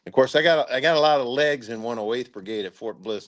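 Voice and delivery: usual speaking voice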